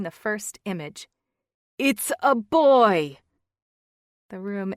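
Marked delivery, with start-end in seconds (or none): 1.8-3.2 slow, loud, jubilant reveal of the news; excited announcement. Acted. Finn announcing the new baby to the family, loud and slow with joy. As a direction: proclaim it like the best news ever.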